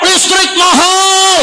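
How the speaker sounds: high, slow mock cry; mock cry of the people. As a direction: plead like the people complaining. Voicing the people crying out.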